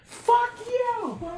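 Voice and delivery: high, loud hammed-up scream; mock top-of-lungs yell. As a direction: yell it as if secretly furious at home. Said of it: "yells at the top of his lungs".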